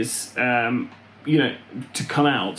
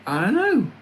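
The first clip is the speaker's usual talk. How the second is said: mock puzzled; 8 semitones higher than usual. Musing in put-on voice.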